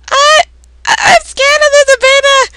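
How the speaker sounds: high, loud, stammering fear; frightened wail. Acted. Scared brother crying to Elizabeta on the phone, in high panic. Frightened character pleads during attack.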